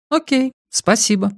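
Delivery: high feminine character voice, accepting; Edith. A narrator's reading. Woman accepting the lift from Rex, high voice. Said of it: "сказала Эдит".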